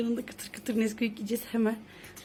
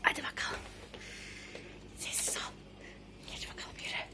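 whispering; 13 dB quieter than usual. Real, not acted. Sneaking to tickle the sleeper.

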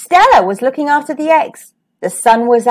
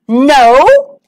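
loud, drawn-out rising "no"; king's indignant outburst; yelled disbelief; 2 semitones higher, 6 dB louder than usual. A narrator's reading. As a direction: swell into an outraged question. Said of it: "he yelled".

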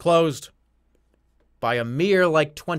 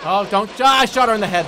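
loud, raised-pitch protest; frustrated shout; 5 semitones higher, 10 dB louder than usual. Real, not acted. Player protesting that the game missed the hit, real frustrated shout. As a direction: cry out at an unfair game.

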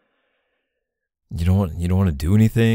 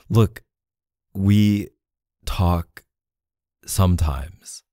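halting, word by word; reluctant admission; slower than usual. Acted. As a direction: admit it bit by bit, with pauses between words. Reluctant admission to suspicious partner.

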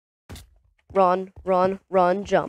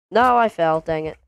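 ordinary talk, nothing marked

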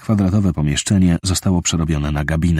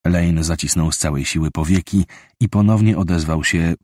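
usual speaking voice

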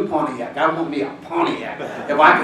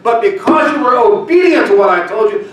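preaching louder and higher as God speaks; rising preaching; 4 semitones higher, 4 dB louder than usual. Acted. Preacher voicing God's blessing to the congregation, building loud.

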